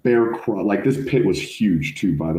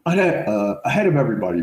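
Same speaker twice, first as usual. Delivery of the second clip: drill sergeant's yell; 7 semitones higher than usual. Retold or imitated. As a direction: demand an answer like a drill sergeant. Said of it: "and he's like".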